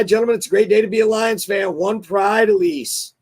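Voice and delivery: ordinary talk, nothing marked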